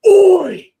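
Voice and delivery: sharp shouted Oi, pitched high and loud. Retold or imitated. Shouting at the neighbour's kid cracking a whip, loud yell. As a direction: yell Oi across the street at the kid. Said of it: "like that".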